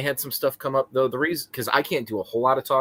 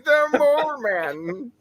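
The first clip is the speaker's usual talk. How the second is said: slow, higher announcer voice; dramatic announcer; 13 semitones higher, much slower than usual. Pretend. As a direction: stretch it out like a trailer. Echoes the dramatic nickname again.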